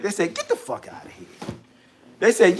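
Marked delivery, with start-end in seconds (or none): 0.3-1.3 low dismissive brush-off. Retold or imitated. Team rejecting the trade offer.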